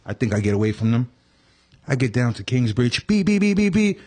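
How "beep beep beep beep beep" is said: high, even honking beeps; imitated car horn. Storyteller imitating cab at Kingsbridge, high honking beeps. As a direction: mimic a nagging cab horn. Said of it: "start honking their horns".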